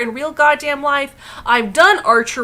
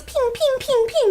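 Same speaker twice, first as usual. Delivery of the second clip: tiny high mock bow sounds; ping like little arrows, way up high; high-pitched pinging; 12 semitones higher than usual. Mocking Hawkeye with his little bow, high-pitched pinging.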